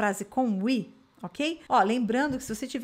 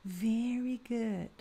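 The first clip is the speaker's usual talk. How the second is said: slow, soft teacher praise; 5 dB quieter, much slower than usual. Acted. Teacher praising students after each repeat, soft and slow. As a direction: reassure the learner gently.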